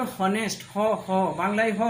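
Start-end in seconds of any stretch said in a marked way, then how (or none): 0.0-0.6 "হনেস্ট" with a sounded H at the start; "হনেস্ট", opening on a spoken "হ"; H pronounced. Shown as a demonstration. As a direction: say the H aloud in "হনেস্ট". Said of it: "এই যদি উচ্চারণ করে নিই".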